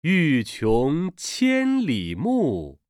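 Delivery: slow, drawn-out recital of Chinese verse; classical poem recitation. Shown as a demonstration. Reader modelling an ancient poem for learners, slow recitation. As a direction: stretch each syllable, pausing between phrases.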